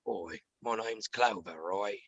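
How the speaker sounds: low, slow British tough guy; quiet, gritty military hard man in a British accent; Clover. Acted. Comedian demonstrates his on-air phone-prank character, gritty and low. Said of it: "character named Clover ... Jason Statham".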